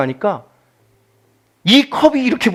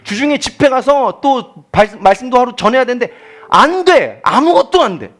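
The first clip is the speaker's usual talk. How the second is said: exasperated; 8 semitones higher, 5 dB louder than usual. Real, not acted. Frustrated recounting, voice rising.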